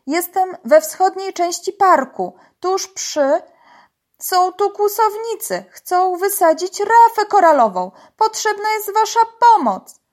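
high, loud emergency report; urgent calling. A narrator's reading. Uncle reports poachers to police, urgently calling. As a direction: raise the alarm, voice pushed high. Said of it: "zawołał po arabsku wuj".

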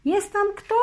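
high, ringing call. A narrator's reading. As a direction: call out across the water. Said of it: "zawołał Wars".

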